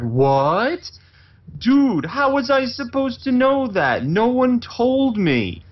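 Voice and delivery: high, slow outraged whine; mock outrage at the courier; indignant whine. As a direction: act wounded and outraged. Indignant at delivery man.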